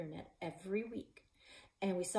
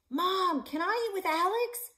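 high, loud pleading call; 13 semitones higher, 8 dB louder than usual. A narrator's reading. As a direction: shout it across the yard. Child yelling to mom.

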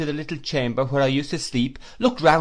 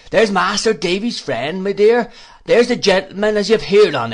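loud, higher, overjoyed rustic gush; animated, all in a breath; 4 semitones higher, 6 dB louder than usual. A narrator's reading. Proud uncle shows his niece the visitors, breathlessly animated. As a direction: pour it out with great pleasure. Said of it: "delivering this speech all in a breath".

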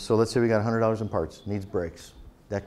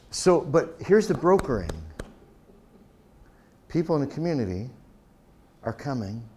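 ordinary talk

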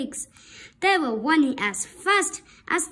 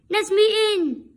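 higher, louder calling wolf; 7 semitones higher, 6 dB louder than usual. Acted. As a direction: call out demanding entry. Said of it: "he called".

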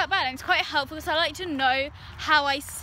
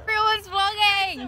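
excited; 5 semitones higher, 4 dB louder, slower than usual. Real, not acted. Excited shout about vlogging.

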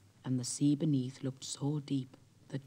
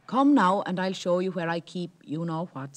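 sly; 4 semitones higher, 8 dB louder than usual. Acted. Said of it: "with a sly look".

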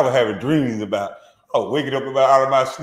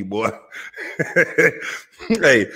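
ordinary delivery

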